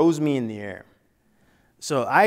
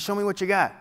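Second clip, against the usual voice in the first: higher-pitched insistent encouragement; urging; 5 semitones higher than usual. Retold or imitated. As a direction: imitate a teammate daring him to fight. Teammate urging him on quietly.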